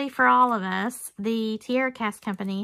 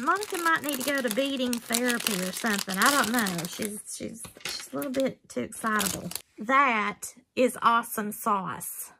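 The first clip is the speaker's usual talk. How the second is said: normal talking voice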